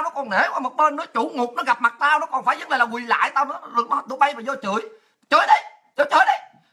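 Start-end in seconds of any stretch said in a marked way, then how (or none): 5.3-6.6 high, loud goading; shouted challenge. Real, not acted. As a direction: dare them on, pitch way up. Daring haters to come curse. (lớn giọng thách thức)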